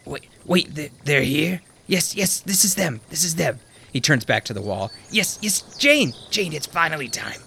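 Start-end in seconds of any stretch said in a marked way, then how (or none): 0.0-3.6 put-on higher, startled old man voice; old man jolted, pitch jumps up; excited old man spotting the visitors. 5.1-7.4 put-on higher, startled old man voice; old man jolted, pitch jumps up; excited old man spotting the visitors. Old man turns back to wall.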